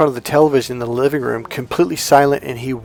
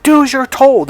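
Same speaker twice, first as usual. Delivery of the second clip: high, louder imitation of mother; mock stern order; 11 semitones higher, 5 dB louder than usual. Uncle quoting the mother's command to her son, mock stern. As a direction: order the child sharply in a raised pitch.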